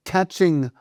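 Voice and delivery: ordinary talk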